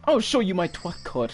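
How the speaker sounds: a hint of a lisping show-off; lightly imitated lisping voice. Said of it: "that was Oswald's kind of tone for voice".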